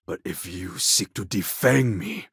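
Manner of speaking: usual speaking voice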